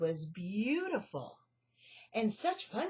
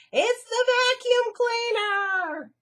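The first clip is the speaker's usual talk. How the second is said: very high, loud, slow shriek; 13 semitones higher, 13 dB louder, slower than usual. Acted. Melissa shrieking at the vacuum cleaner. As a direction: shriek in terror. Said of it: "shrieked Melissa".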